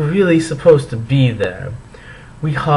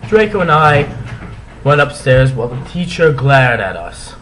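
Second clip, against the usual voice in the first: ordinary delivery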